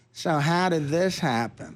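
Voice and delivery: slow, nosy butting-in voice; slow intrusive manager. Retold or imitated. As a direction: barge in with a slow smug question. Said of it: "and go".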